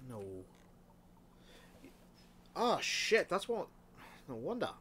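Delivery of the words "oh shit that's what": surprised. Real, not acted. Realising the oil problem.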